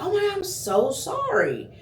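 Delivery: high, sugary fake-apologetic waiter voice; mock the waiter's syrupy apology, way up high. Vlogger mocking the waiter's fake sorry, high and sugary. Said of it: "When he finally was like".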